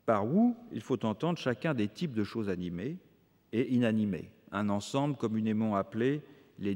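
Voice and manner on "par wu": ordinary delivery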